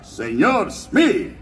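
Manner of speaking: grito teatral y muy alargado de capitán pirata; llama alargando mucho, con subidas de tono; James Garfio. Shown as a demonstration. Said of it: "que gritaba". (grito impostado de Garfio)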